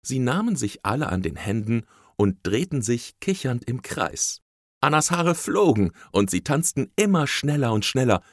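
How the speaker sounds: usual speaking voice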